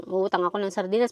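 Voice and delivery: quick, mumbled sulky repeat; sulky mimicking. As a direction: grumble it back like a reluctant kid. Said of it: "Mukha kami na".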